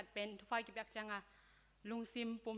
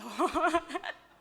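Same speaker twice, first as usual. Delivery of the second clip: loud, high burst of laughter; 6 semitones higher, 9 dB louder than usual. Real, not acted. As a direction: break into a loud, rising laugh. Speaker laughs after own remark.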